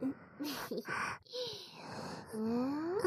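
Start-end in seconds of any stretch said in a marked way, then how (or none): 0.4-2.3 soft teasing giggle. Acted. Teasing laugh at listener.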